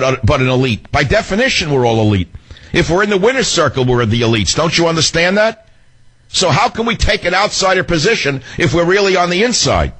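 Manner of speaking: high, heated ranting; rising rant. Real, not acted. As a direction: rail at the hypocrisy, pitched high. Heated rant about hypocrisy.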